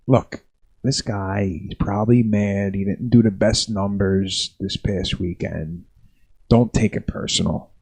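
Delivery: quiet, slow, low reassurance; calming club owner. Retold or imitated. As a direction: talk him down softly, slowly. Club owner calming upset comic.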